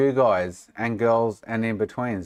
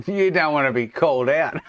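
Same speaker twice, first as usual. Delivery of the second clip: higher, louder, breaking into real laughter; laughing teasing; 6 semitones higher, 5 dB louder than usual. Real, not acted. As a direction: tease them, then crack up loudly. Cracks up teasing a regular donor.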